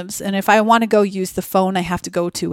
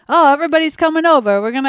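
high, loud, fast Jersey Italian mock voice; Jersey accent; 8 semitones higher, 4 dB louder, faster than usual. Comic recalling an Italian family, bad Jersey accent. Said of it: "it's like a Jersey".

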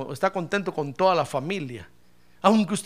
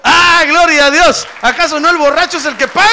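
much higher, very loud cry to the congregation; exultant preaching shout; 8 semitones higher, 14 dB louder than usual. Real, not acted. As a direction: shout it with full pulpit force. Preacher shouts praise, challenges congregation. (grito exaltado de predicador)

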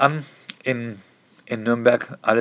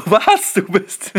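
shocked squeal of recognition; surprised shriek; 7 semitones higher, much slower than usual. Retold or imitated. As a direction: jump way up in pitch on Was. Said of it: "Und sie so". (überraschter, hoher Aufschrei)

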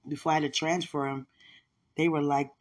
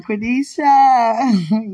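slow, loud, drawn-out name call; God calling out to the frustrated mother; God calling her; 7 semitones higher, 11 dB louder, much slower than usual. Retold or imitated. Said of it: "And God was like".

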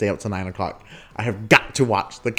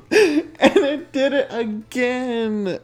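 high, slow, drawn-out laughing wail; much slower than usual. Real, not acted. Retelling the wrong episode coming on, laughing. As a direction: squeeze it out through laughter. Laughing at his retold disappointment.

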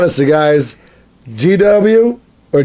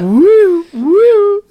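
high, loud woo; whooping; 3 dB louder than usual. Real, not acted. Laughing at a rambling quote with loud whoops. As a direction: whoop at the absurdity.

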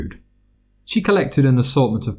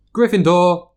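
announcing; 9 semitones higher, 3 dB louder than usual. A narrator's reading. Hat announces house.

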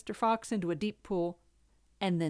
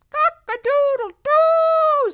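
loud, slow, high panicked crow; screaming rooster crow; 14 dB louder, much slower than usual. Acted. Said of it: "screamed the Rooster".

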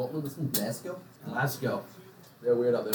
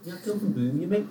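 higher-pitched mock Brummie; attempted Birmingham accent; 6 semitones higher than usual. Shown as a demonstration. Showing friends how people from Birmingham talk, mock Brummie accent. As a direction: put on a rough Brummie lilt, pitched up. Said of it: "I can't do it".

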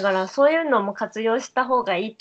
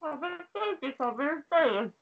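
talking while chewing; words garbled by a mouthful of food; mouth full; 4 semitones higher, 3 dB quieter than usual. Real, not acted. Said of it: "もう何言ってるか分かんない".

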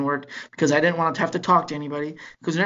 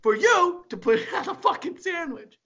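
mock rant; 7 semitones higher than usual. Quoting Bill Burr rant.

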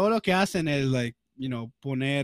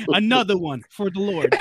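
mock hype-man catchphrase, louder and laughing; 5 semitones higher, 6 dB louder than usual.

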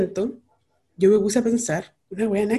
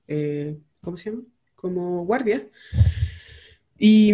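ordinary talk

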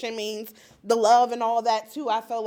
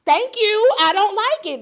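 high, loud burst of emphatic agreement; mock husband finally getting it, loud and high; 13 semitones higher, 13 dB louder than usual. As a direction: shout the agreement like it's obvious. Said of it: "This man was like".

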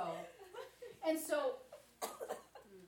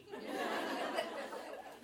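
silent wheezy chuckle; real airy giggle; breathy laugh. Real, not acted. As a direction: laugh at the irony. Laughing at another church.

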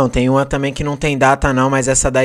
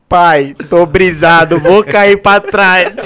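slow, drawn-out stoned voice; 4 semitones higher, 5 dB louder, much slower than usual. Retold or imitated. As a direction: drag it out like you're high. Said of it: "ele fala isso".

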